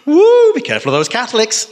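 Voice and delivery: high, loud spooky warning voice; mock warning. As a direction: ham up a fearful warning. Mocking warnings from his upbringing.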